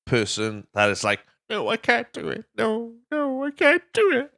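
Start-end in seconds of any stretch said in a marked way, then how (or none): 1.5-4.3 very high, whiny put-on voice; mock whiny refusal. Mocking a cowardly character's high whine. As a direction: squeak it out like a scared wimp.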